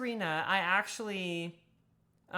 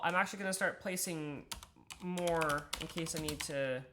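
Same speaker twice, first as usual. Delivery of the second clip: ordinary talk, nothing marked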